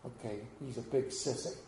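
a hint of a scared child's quaver; little shaky voice. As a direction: sound like a nervous little kid giving in. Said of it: "in a little shaky voice said".

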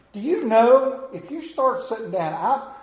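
ordinary talk

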